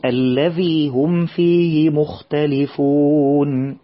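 الذي هم فيه مختلفون recited slowly with careful tajweed; correct tajweed recitation. Shown as a demonstration. Teacher models the correct verse for children, slow and careful. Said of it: "نقول".

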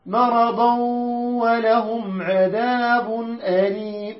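slow measured tajweed chant; Quran recitation. Shown as a demonstration. Teacher recites the verse to the class in slow tajweed. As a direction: draw each word out like tilawa.

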